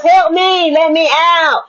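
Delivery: high, pleading call for help; trapped child crying out. Acted. Acting the trapped inner child.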